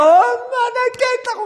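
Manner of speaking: put-on high, slow whining child voice; whiny child. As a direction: whine to mom like a small kid. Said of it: "그냥 징징대고". (엄마에게 징징대는 아이 흉내)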